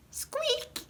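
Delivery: very high, soft squeak; mouse squeak. Acted. Reading a baby book to children, high mouse squeak. Baby says animal sounds.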